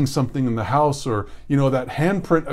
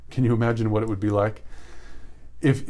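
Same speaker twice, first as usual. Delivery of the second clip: normal talking voice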